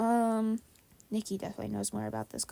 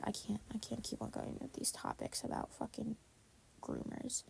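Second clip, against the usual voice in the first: unvoiced, under the breath; whispered aside; 12 dB quieter than usual. Real, not acted. Host muttering disgust about the topic, whispered.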